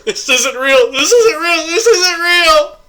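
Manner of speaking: high panicked wail, building; rising hysterical cry, voice breaking; panicked crying shout. Pretend. Panicking in VR porn scene.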